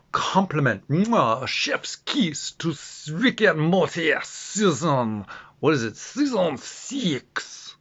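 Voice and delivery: put-on slow, drawn-out French Canadian accent with a kiss sound. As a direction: savour each word like a French Canadian chef. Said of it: "I'm doing a French Canadian accent".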